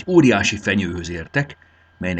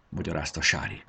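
plain reading voice

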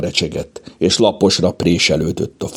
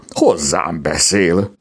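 slow, incredulous wonder; drawn-out amazement; disbelieving; slower than usual. A narrator's reading. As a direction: linger on it in disbelief. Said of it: "rázta meg hitetlenkedve a fejét".

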